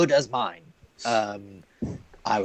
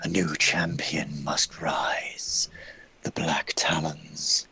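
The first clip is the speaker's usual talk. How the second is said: slow, low unvoiced blade whisper; eerie whisper; 6 semitones lower, 4 dB quieter, much slower than usual. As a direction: breathe it like a cursed voice. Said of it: "you can just hear this whispering".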